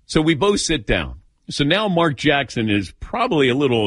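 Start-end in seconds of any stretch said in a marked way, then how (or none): none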